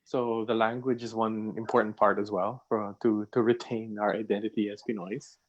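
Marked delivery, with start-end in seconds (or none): none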